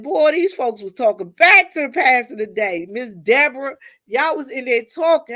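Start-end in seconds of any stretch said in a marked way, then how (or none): 0.0-2.9 raised, lively voice, a touch louder; excited retelling. Retold or imitated. To church friends about folks talking back, excited. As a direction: tell it with delighted surprise. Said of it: "I said".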